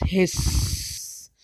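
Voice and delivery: breathy drawn-out hiss; snake hiss. Retold or imitated. Presenter showing the class a snake's hiss. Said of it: "Snake says".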